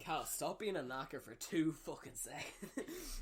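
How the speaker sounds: slow, laughing telling-off; mock scolding. Friend teasing actor as the coach, slow mock scolding. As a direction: rib him as the fed-up coach.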